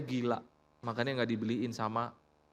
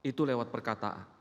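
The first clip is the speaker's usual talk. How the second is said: ordinary delivery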